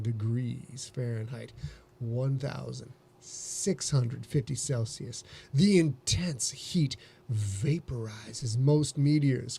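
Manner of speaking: slow, low seductive read; sexy voice. Pretend. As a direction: purr it out slowly and seductively. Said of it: "We're gonna do this in a sexy voice".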